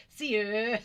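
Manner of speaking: loud, high, hammed-up "Seer"; playful exaggeration. Playing with a word for the viewers, exaggerated and laughing. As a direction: ham up "Seer" between giggles.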